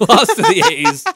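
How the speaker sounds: real laughter breaking through, high and loud; loud, high-pitched laughing delivery. Real, not acted. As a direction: crack up while saying it. Laughing over the team losing.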